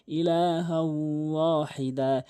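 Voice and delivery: "ইলাহা ওয়াহিদা" slow, long nasal w between the words; recited with ghunnah. Shown as a demonstration. Teacher models idgham for learners, slow nasal join into "ওয়াহিদা". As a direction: draw out the glide into "ওয়াহিদা". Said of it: "গুণনা করবেন".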